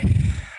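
a big, loud nervous exhale before speaking; heavy sigh of nerves; deep sigh. Real, not acted. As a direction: breathe out hard, bracing yourself. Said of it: "took a deep breath".

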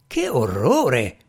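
lenta esclamazione d'orrore; esclamazione inorridita. A narrator's reading. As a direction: allunga le parole, scandalizzato. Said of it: "esclamò il colonnello Grant". (orrore enfatico)

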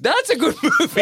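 laughing through the words; amused, breaking into laughter. Real, not acted. Laughing at own joke.

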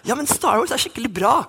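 put-on high, eager kid voice; child voice. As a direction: imitate the son insisting on Star Wars. Son's reply retold. (ivrig barnestemme)

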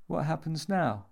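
high, anxious question; nervous near-whisper. A narrator's reading. Jimmy surrenders the book and asks, high and fearful. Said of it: "he whispered".

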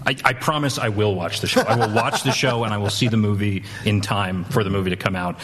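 ordinary talk, nothing marked

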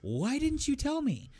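high, drawn-out wail of a question; slow, high mock protest; mock indignant.

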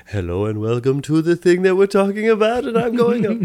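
imitated host greeting, rising steadily to a high peak; pitch climbing up the scale. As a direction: exaggerate a presenter's endlessly rising intonation. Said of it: "he's just going up and up on the scale".

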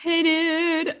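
attempted British accent, pitched high; high, playful stab at a British accent. Imitating English cops to a friend, mock British accent. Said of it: "Except with a British accent".

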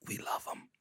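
hushed whisper; whispered praise. Retold or imitated. The audience's verdict, whispered. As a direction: whisper it like a secret.

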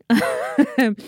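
short high chuckle; little giggle to oneself; self-amused laugh. Real, not acted. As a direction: laugh at yourself briefly. Laughs at her own accent bit.

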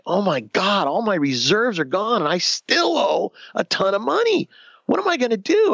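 high distressed outcry; panicked. Retold or imitated. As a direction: spiral into alarm over the debt. Realising reserves are gone.

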